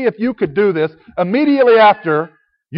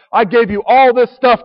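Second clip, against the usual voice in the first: lightly acted divine reproach, raised and slow; God's reproach; 5 semitones higher, 5 dB louder than usual. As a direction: push it louder and higher, drawn out, reproaching. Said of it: "God says".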